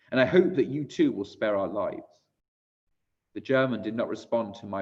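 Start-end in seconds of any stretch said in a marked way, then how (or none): none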